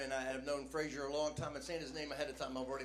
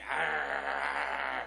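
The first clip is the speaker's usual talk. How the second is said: punk growl; 3 semitones lower, 5 dB louder than usual. Retold or imitated. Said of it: "the whole energy's like".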